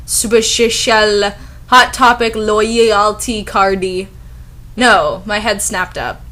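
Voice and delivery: normal talking voice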